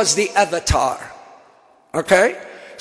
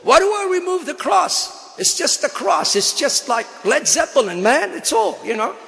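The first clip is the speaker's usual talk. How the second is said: baffled protest; 6 semitones higher than usual. Retold or imitated. Acts out his baffled protest.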